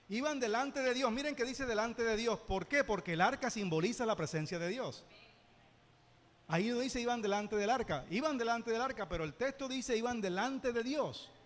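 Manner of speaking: loud preaching, driving each word home; fervent preaching. Acted. Pastor urging the church: loud fervent preaching. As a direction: preach it hard to the congregation.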